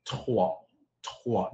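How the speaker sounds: "trois" with a French guttural R; throaty French R. Shown as a demonstration. Teaching students three in French, throaty R. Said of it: "Use your throat".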